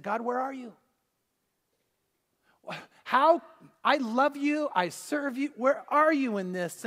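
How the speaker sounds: desperate plea to God; pleading. Retold or imitated. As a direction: beg God for an answer, voice straining up. Man crying out to God.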